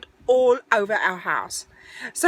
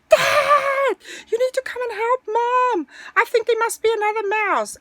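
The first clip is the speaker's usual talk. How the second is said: high-pitched urgent yell to Dad; calling for help; 13 semitones higher than usual. Retold or imitated. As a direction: imitate a girl calling her father. Daughter voiced calling for help.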